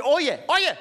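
a hint of a high mock Miami accent. Comic quoting Miami locals with a hint of a mock Miami accent. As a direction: toss off oh yeah the Miami way. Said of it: "they say".

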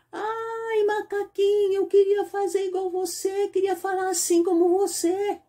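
high, wishful duckling voice; duckling longing to talk like the monkey; admiring little duckling. Acted. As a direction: plead sweetly like a tiny duck.

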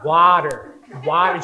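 "water" loud, with a d and hard final r; exaggerated American imitation of "water"; overdone American water. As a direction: overdo an American "wah-derr". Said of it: "she'll overdo it".

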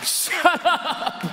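put-on curt scolding parent telling kids off; stern parent. Preacher voicing a parent scolding his kids, curt and stern. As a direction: snap it like a fed-up dad. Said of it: "the same way I speak to my kids".

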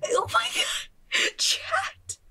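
very high startled shriek at chat; shocked shriek. Real, not acted. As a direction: shriek it out, genuinely stunned. Shocked by chat's donation.

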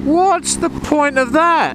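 high, slow, drawn-out disbelief; incredulous. Real, not acted. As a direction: stretch it out high, utterly baffled. Incredulous at rider removing helmet.

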